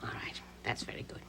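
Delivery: whispering. Real, not acted. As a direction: whisper it gently, breathy and unvoiced. Gently questioning a small child.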